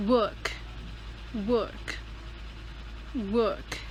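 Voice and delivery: "work" with no R, British style; silent R. Shown as a demonstration. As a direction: say "work" as "wuhk", crisply on its own. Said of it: "you don't have to say the R".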